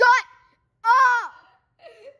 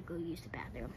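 soft, breathy whisper; 8 semitones lower, 16 dB quieter than usual. Real, not acted. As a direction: drop to a secret whisper. Drops to whisper.

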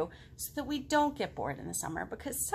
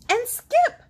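much higher, louder, slow; eager kid; 14 semitones higher, 12 dB louder than usual. Acted. Girl in the book boasting to the potato, high and loud. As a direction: show off proudly. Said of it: "girl boasts".

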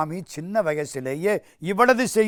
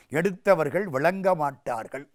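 ordinary talk, nothing marked

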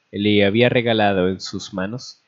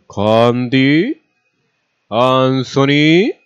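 loud, drawn-out call rising at the end; calling out names; 4 semitones higher, 6 dB louder, much slower than usual. A narrator's reading. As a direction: call across a distance, stretching each name. Calling out names at departing train.

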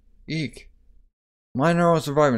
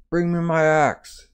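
gruff demand; 6 semitones higher, slower than usual. Acted. Wounded warrior demands his axe.

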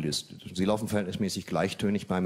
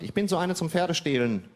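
higher, chirpy dating cliché; mock woman's line; 8 semitones higher than usual. Mimicking a woman's dating phrase, higher and chirpy. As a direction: parody her cliché brightly. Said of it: "wo die Frau sagt".

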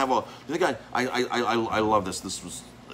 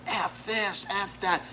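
high, strained mock yell of the angry guest; retold screaming, pitched way up; 11 semitones higher, much slower than usual. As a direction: imitate him screaming curses. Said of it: "screaming at the top of his lungs".

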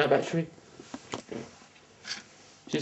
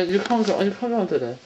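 ordinary talk, nothing marked

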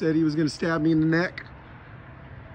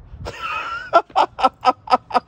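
a sudden squeaky burst of laughter; high squealing laugh. Real, not acted. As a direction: laugh high and helplessly at yourself. Laughs at his own video.

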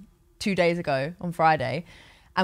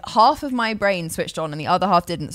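ordinary delivery

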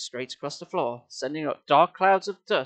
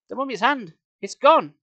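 high, urgent announcement, getting louder; a touch of panic in a raised voice; panicked; 8 semitones higher, 6 dB louder than usual. A narrator's reading. Boy telling Sari the hand is lost, panicked. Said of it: "my voice choked with panic".

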